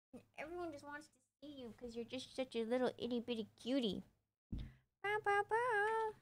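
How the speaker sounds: high, soft cooing pet voice; gushing over the puppy, high and hushed. Real, not acted. Baby talk to her dog.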